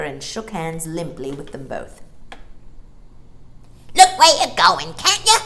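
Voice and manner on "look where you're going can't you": much higher, loud angry shout. Acted. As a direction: yell at whoever bumped you. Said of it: "he shouted".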